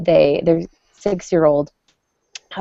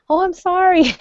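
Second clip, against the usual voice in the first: much higher, sing-song mock "Oh, I'm sorry"; mock kid apology; 13 semitones higher, 3 dB louder than usual. Mother mocking her kids' easy apology, high mock child voice. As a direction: send up a kid's empty sorry. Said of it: "walk all over us saying".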